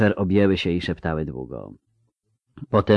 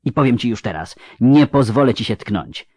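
hissed; 5 semitones higher, 5 dB louder than usual. Acted. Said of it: "zasyczała".

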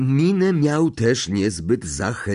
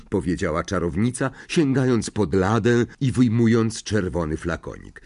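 plain reading voice

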